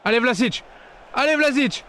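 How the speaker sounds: excited urging. Real, not acted. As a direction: push him on, building up. Urging before goal.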